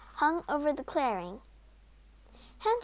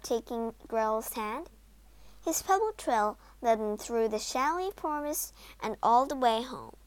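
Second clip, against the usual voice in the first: ordinary delivery, nothing marked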